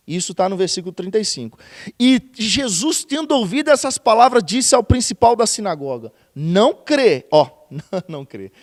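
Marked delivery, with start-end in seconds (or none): 2.0-7.3 loud, slowly proclaimed verse; preaching scripture reading. Acted. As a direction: ring it out from the pulpit. Reads scripture at full force.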